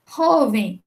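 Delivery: "Jovem" à espanhola, com o JO forte e aspirado; penúltima sílaba tônica. Shown as a demonstration. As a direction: dizer "Jovem" mais alto, batendo no "Jo". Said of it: "a penúltima sílaba é a tônica". ("Jovem" com a primeira sílaba destacada)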